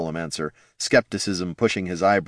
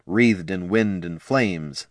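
plain reading voice

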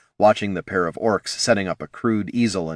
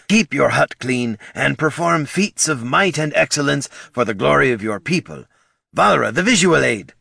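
higher, louder salesman-like orc; eager orc presenter; 7 semitones higher, 4 dB louder than usual. A narrator's reading. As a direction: pitch it like an eager pitchman.

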